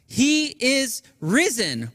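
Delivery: slow, high proclamation; declaring. Acted. Pastor proclaims the Easter greeting, slow and lifted. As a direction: declare it to the world. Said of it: "declaring to the world".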